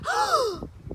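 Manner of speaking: high, loud shocked gasp. A narrator's reading. May catching Junie B talking, loud gasp. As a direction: gasp loudly like a tattletale. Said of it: "May did a loud gasp".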